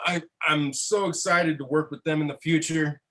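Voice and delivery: normal talking voice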